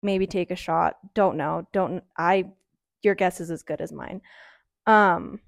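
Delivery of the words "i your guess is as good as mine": ordinary talk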